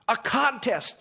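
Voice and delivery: high, loud excited cry; excited scream. A narrator's reading. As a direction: squeal it like eager ducks. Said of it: "screamed the ducks".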